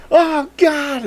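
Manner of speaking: high mock panic yelp; panicked cry. Retelling the Earth vanishing, a high panicked yelp. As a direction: squeal it like the world just ended.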